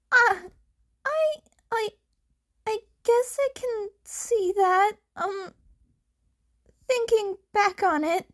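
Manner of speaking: sheepish Izuku. Acted. Izuku admits Uraraka has a point, stammering sheepishly. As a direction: caught out and embarrassed.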